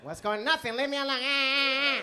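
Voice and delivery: high, loud, slow furious shout; hammed-up angry girlfriend. Angry girlfriend shouting at her boyfriend, high and loud. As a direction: blow up like a fiery girlfriend.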